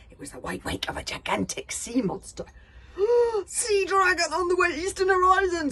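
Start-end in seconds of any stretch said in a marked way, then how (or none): 2.5-3.4 sharp alarmed gasp; loud high gasp. Acted. Crew gasps at the giant sea monster. 3.5-5.7 high loud scream; screaming alarm. Acted. Villain screams a warning about the sea dragon. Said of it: "screamed Norbert".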